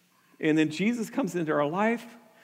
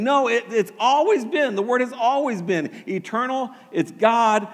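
ordinary talk

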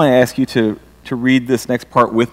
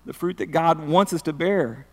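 normal talking voice

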